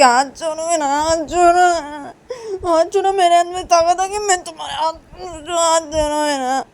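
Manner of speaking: high, loud, tearful protest; acted whiny shouting; distressed demand. Demands her hand be released.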